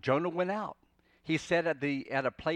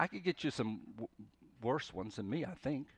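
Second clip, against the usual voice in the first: usual speaking voice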